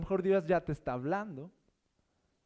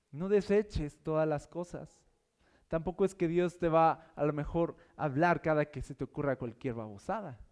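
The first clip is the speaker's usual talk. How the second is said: ordinary talk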